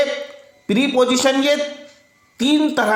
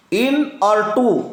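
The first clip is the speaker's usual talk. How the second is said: slow; much slower than usual. Shown as a demonstration. Teacher spelling out the parts.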